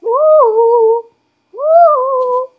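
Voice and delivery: high loud whoops; cheering whoop. Real, not acted. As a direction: whoop with joy.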